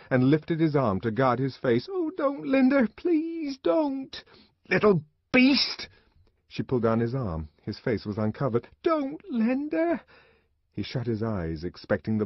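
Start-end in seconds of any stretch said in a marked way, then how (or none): 1.9-4.2 put-on high, slow child's begging; frightened pleading. A narrator's reading. Boy begs not to be hit. 4.7-5.9 high, loud, drawn-out insult; angry shout. A narrator's reading. Mother about to hit child. 8.8-10.0 put-on high, drawn-out child's cry; frightened pleading. A narrator's reading. Boy expecting the blow.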